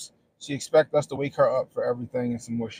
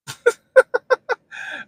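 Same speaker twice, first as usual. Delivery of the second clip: loud high laugh; 9 dB louder than usual. Real, not acted. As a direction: burst of high-pitched laughter. Laughing at daughter.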